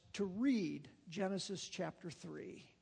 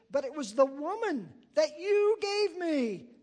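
higher, louder protest shifting the blame; Adam pleads his excuse up high and loud; defensive Adam; 7 semitones higher, 9 dB louder than usual. Acted. Adam blames Eve before God.

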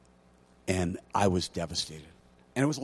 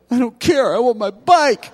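put-on high, louder whiny little boy; whiny kid; 10 dB louder than usual. Boy refuses his mom's comfort, whining like a kid. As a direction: throw a childish tantrum.

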